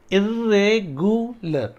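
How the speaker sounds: इर्रेगुलर slowly stretched: इर्रे, गु, लर; syllable breakdown. Shown as a demonstration. Teacher showing a four-syllable word, slowly stretched. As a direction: draw out इर्रेगुलर part by part. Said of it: "ये चार syllable हो गए".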